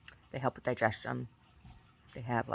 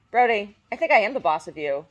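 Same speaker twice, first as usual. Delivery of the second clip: raised voice to dog; 8 semitones higher, 9 dB louder than usual. Real, not acted. Mom answers her dog.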